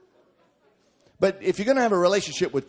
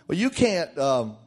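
ordinary talk

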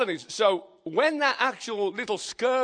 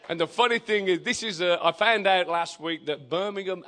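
ordinary talk

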